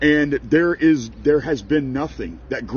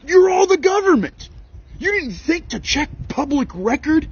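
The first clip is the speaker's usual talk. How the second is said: real outraged shout; 10 semitones higher than usual. Real, not acted. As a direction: yell it at the government in disbelief. Ranting at tax agency.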